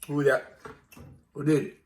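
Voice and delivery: loud scolding of the dog. Real, not acted.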